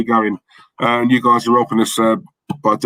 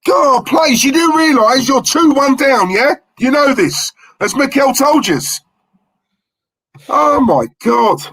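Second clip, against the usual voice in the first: high, despairing rant; high wail of fan frustration, drawn out at the end; exasperated pleading; 10 semitones higher, slower than usual. Real, not acted. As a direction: plead with your team, voice pitched way up. Pleading with the losing team.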